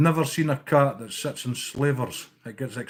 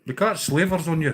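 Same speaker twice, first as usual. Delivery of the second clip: ordinary delivery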